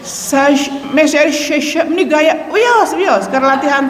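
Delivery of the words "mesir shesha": replace each s in "shesha" with sh; sya sound. Shown as a demonstration. Teacher showing the Egyptian-style sya sound. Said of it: "pakai huruf sya".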